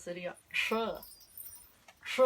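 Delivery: "sh" con sonrisa y dientes juntos; sonido sh. Shown as a demonstration. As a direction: sisea "sh" dos veces, dientes juntos. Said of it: "la sonrisa con los dientes juntos". ("sh" con los labios en sonrisa)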